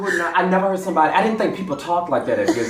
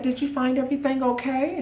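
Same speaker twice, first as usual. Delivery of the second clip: cashier voice; 12 semitones higher, 4 dB quieter than usual. Retold or imitated.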